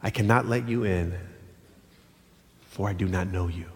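low, soft, grave refusal; solemn bridegroom. Acted. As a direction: deny them gently but firmly. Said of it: "this is what the bridegroom says".

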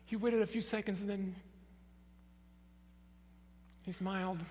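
usual speaking voice

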